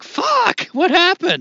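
sudden high, loud cry of dismay; dismayed outburst. Retold or imitated. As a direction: blurt it out like the take just fell apart. Retelling a disappointing recording.

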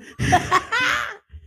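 real excited laugh; high excited laugh. Real, not acted. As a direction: burst of giddy high laughter. Getting excited about the book.

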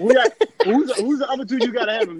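high, fast laughing question; laughing challenge. Real, not acted. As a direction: press him while laughing.

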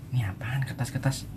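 whispered puzzled thought. Retold or imitated. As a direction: breathe it to yourself. Retold thought while checking his pocket.

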